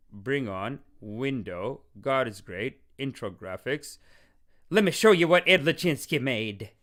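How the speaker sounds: normal talking voice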